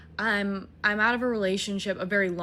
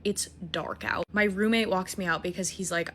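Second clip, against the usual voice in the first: ordinary talk, nothing marked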